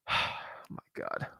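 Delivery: slow breathy whisper of disbelief; exasperated whisper. Real, not acted. Exasperated after guru's offer.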